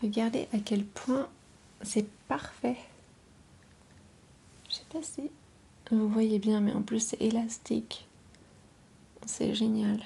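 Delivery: voix chuchotée douce et proche; chuchotement ASMR. Acted. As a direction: chuchoter tout près du micro. ASMR whisper with play-dough. (murmure ASMR)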